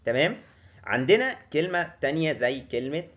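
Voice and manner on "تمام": ordinary talk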